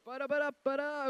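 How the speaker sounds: scatted jingle. Pretend. Announcer filling the wait for the crowd, with a loud sung jingle. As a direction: hum a little fanfare into the mic. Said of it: "I can just make the sound effects".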